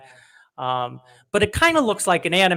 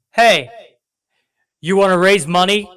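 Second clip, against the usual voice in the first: louder, bright salesy pitch; voicing PayPal; 3 semitones higher, 7 dB louder than usual. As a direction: pitch it like an eager app. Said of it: "PayPal goes like this".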